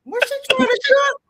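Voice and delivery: mother laughing at her daughter's cooking; teasing mom, laughing. Retold or imitated. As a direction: squeal the question through laughter. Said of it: "I could really feel my mom".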